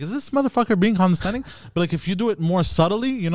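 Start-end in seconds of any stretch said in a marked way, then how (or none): 0.0-1.4 higher-pitched mock suspicion; suspicious customer. Customer doubting the salesman, mock suspicious question. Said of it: "I'll be like".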